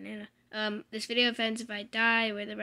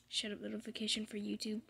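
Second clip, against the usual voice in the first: whisper; 7 dB quieter than usual. Real, not acted. Hushed aside at notification.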